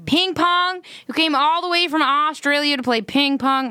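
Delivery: put-on lightly done American accent asking about ping pong. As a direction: imitate an incredulous American. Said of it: "You do a good American accent".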